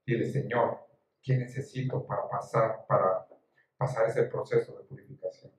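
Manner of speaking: soft, low pastoral invitation with long pauses; gentle exhortation. Real, not acted. Preacher inviting each listener to pause and pray, gentle exhortation. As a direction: ease them quietly into private prayer.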